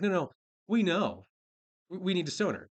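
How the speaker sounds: lightly acted, emphatic correction; mock insistence. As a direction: play them cutting in, sure of themselves. Acting out the accusers' insistence.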